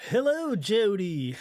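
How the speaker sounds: bad high Australian accent; a cheesy Australian accent greeting. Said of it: "That was my worst Australian accent ever".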